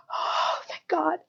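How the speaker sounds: whispered, breathy relief; relieved whisper. Retold or imitated. Carrie whispering relief to Duncan about her chapter. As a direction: exhale it like a huge relief.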